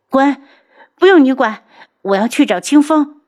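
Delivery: loud, high-pitched snapping; high, fierce refusal; angry dismissal. Acted. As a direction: drive the helper away sharply. Pushes maid away, snapping.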